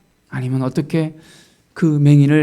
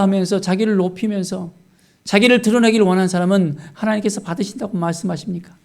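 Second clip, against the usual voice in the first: usual speaking voice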